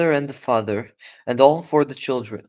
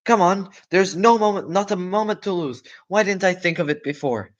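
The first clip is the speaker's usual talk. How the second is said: high, loud eureka shout; father fox shouting his plan; excited shout; 8 semitones higher, 6 dB louder than usual. Acted. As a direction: burst out with the idea. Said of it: "He jumped up and shouted".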